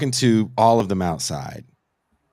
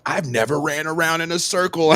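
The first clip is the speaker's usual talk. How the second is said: higher, thrilled first-timer's exclamation; delighted concertgoer; 8 semitones higher than usual. Retold or imitated. Mosh pit newcomer marvels at running in circles, imitated excitement. Excited crowd member quoted.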